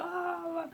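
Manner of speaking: high squealing ah ah ah; mock panicked squeals. Mocking Hanson's panic with high squeals. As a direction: squeal like a scared parody.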